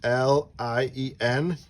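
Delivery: letters spelled out slowly one by one; slow spelling. Shown as a demonstration. Parent spelling a name slowly for the child. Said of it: "spells the name letter by letter".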